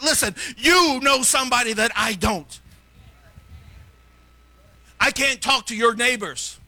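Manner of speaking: high, driving sermon delivery; full-force preaching. Real, not acted. Preacher telling congregation it is their job, forceful exhortation.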